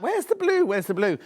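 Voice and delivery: high, fretful asking where the blue is; mock-panic hunt for the missing colour; puzzled searching. Presenter recalling her search for the binding, high and fretful. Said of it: "I was like".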